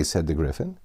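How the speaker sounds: usual speaking voice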